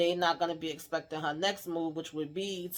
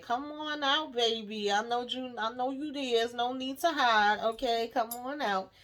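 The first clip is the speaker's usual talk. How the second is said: lightly acted coaxing, pitched higher; [name]'s coaxing; 6 semitones higher than usual. [name] telling Lily no need to hide, mild coaxing. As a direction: coax a hider out in a higher voice.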